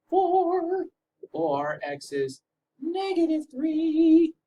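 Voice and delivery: squeaky high voice; high-pitched. Pretend. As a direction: announce the answer in a squeaky voice. Teacher announcing answer in high voice.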